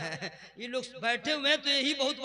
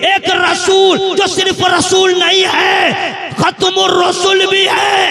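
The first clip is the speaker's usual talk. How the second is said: slow, much louder, ringing sermon voice; preaching at full force; 7 semitones higher, 21 dB louder, slower than usual. Acted. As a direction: thunder each line to the crowd. (पूरे जोश में तक़रीर)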